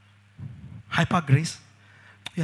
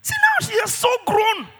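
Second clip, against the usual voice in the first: proud excited exclaim; 13 semitones higher than usual. Acted. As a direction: show off the grown child proudly. Proud mother showing child.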